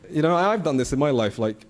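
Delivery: usual speaking voice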